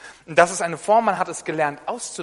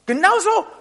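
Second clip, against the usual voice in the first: "Genauso" called out high and loud; emphatic cry; 13 semitones higher, 8 dB louder than usual. Real, not acted. Preacher drives the point home.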